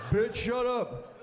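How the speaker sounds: slow, hammed-up scolding; mock angry snap. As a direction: stretch it out like a mock threat. Women beating up a grandmother.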